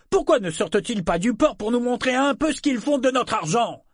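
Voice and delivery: high-pitched indignant townsman's challenge; protest, pitch climbing with each question. A narrator's reading. Townsman challenging the council over pirates, indignant. Crowd member protests about taxes.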